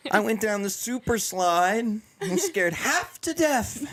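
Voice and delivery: put-on high, stretched-out child voice; imitating the Apparently kid. Host reciting a viral kid's super slide line, high mock-kid voice.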